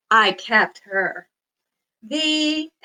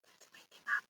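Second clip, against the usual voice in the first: whisper; 19 dB quieter, faster than usual. Shown as a demonstration. Whispered after the quiet song.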